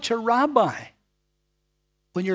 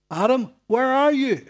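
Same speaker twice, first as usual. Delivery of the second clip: loud, higher calling voice; God calling out; 5 semitones higher, 7 dB louder than usual. Acted. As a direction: call out across the garden, searching. Preacher voicing God calling Adam.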